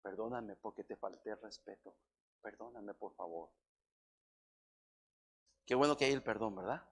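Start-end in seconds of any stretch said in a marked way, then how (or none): 0.0-3.5 wife apologizing. Retold or imitated. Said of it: "luego me dice".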